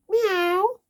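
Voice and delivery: high, loud cat meow from a chicken. A narrator's reading. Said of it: "said the chicken".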